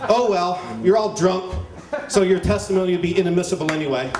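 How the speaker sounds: ordinary delivery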